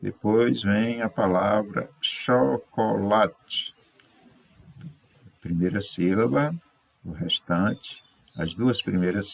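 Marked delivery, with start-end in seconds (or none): 2.0-3.8 slow cho-co-la-te, syllables set apart. Shown as a demonstration. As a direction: sound out "chocolate" in clear, slow syllables. Teacher presents word to split. ("chocolate" pausado, sílaba por sílaba)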